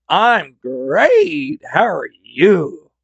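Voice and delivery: high, goofy voice with a big smile; dopey, dazed man. Retold or imitated. Woozy driver answers the storyteller, dopey and high. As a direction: put on a high, drawn-out dopey greeting. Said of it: "with this big dopey smile".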